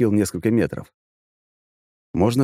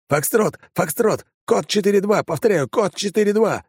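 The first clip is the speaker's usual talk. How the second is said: high urgent radio shout; 13 semitones higher than usual. Acted. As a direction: yell the code over gunfire. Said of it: "кричал в рацию охранник".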